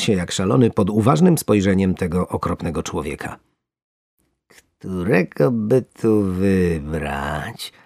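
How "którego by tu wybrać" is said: slow, drawn-out relishing voice; gloating fisherman. Acted. Fisherman picks a fat worm with relish. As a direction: linger over each word.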